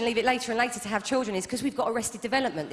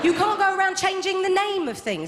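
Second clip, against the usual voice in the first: loud, high outraged rant; comic outrage, full volume; exasperated shout; 8 semitones higher, 4 dB louder than usual. Real, not acted. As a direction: shout it like the last straw. Ranting about renamed car features.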